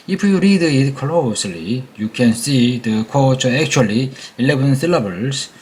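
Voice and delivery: ordinary delivery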